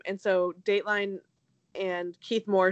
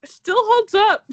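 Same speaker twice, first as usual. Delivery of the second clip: excited; 13 semitones higher, 11 dB louder than usual. Real, not acted. Playful defense of mindless show.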